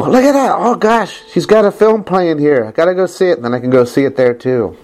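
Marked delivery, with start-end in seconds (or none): 0.0-3.4 bright, much higher pitched thrill at a discovery; playful mock excitement, voice leaping up; excited gasp. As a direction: gush like a fan spotting a poster. Imagined self spotting her film.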